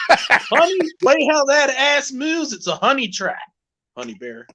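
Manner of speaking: hammed-up sweet-talking pickup voice; catcaller impression. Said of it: "Do another one, [name]".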